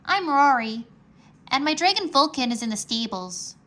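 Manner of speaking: put-on young boy's eager voice, lightly done; Rory's voice. A narrator's reading. Rory voiced by narrator.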